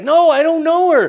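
high, loud acted protest; emphatic denial. Preacher as Peter denying the waitress's claim, loudly emphatic. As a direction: deny it hard, pitch jumping up.

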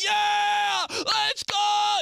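imitating a stadium fan; fan cheer. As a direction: yell like a fan on third down.

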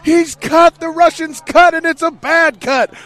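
shouting it like a fight announcer; big ringside call, high and loud; Rocky announcer yell. Retold or imitated. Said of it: "and they're like".

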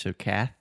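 cath with a clear th sound; teaching syllable. Shown as a demonstration. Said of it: "And then you have the T H".

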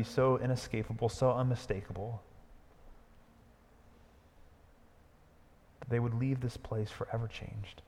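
prayer. Acted. Pastor praying for the congregation, hushed. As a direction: pray gently over the people.

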